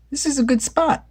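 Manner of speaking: high, bright-eyed Velma voice. A narrator's reading. Velma choosing the spot, high character voice. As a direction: play Velma pleased with the spot. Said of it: "said Velma".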